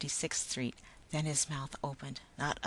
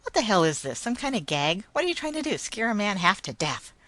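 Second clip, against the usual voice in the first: loud, hostile demand; angry outburst; 6 semitones higher, 8 dB louder than usual. A narrator's reading. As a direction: bark it at the stranger. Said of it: "His voice was hostile now".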